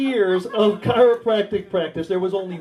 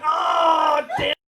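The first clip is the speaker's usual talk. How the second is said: high, drawn-out mock wail; mock frustrated cry; 8 semitones higher, slower than usual. Chiropractor reacting to her next booking, mock anguish.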